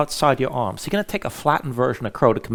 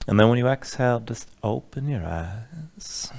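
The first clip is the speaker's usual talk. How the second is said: guided meditation; 4 semitones lower, 5 dB quieter than usual. Acted. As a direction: ease them into calm breathing. Leads a relaxation breathing sequence.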